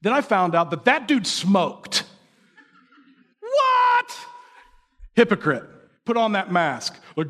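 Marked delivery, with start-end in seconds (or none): 3.4-4.2 high squeak of disbelief; hammed-up high shocked what. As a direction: yelp it in mock shock. Finding out the dude smoked.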